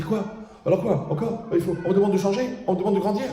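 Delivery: high, incredulous string of questions; mock indignation. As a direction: protest in a high mock-outraged voice. Acts out people refusing his solution.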